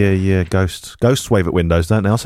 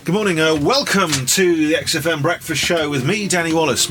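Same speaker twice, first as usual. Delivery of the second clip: radio presenter voice; 8 semitones higher than usual. Acted. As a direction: greet the listeners like a breakfast host. Rehearsing radio show opening.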